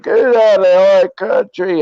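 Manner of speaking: put-on loud, high drunken shout. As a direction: hurl it like a belligerent drunk. Drunk racist yelling at Spanish ladies.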